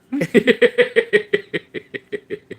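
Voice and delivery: loud, high rattling laugh; high cackle. Real, not acted. Delighted by the cat, high loud cackling. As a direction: let out a long high cackle.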